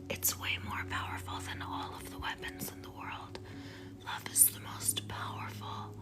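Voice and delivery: breathy secret whisper, barely voiced. Telling viewers love beats weapons, in a hushed whisper.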